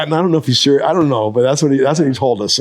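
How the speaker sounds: normal talking voice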